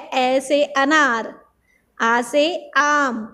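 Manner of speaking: slow, drawn-out letter chant; slow recitation. Shown as a demonstration. Teacher reciting alphabet to children, slow and drawn out. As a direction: recite each letter slowly for the class. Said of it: "teacher reciting letters for pupils".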